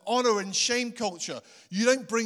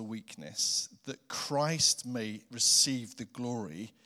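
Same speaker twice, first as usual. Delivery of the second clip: prayer; 6 semitones lower than usual. Acted. Leads the congregation in prayer.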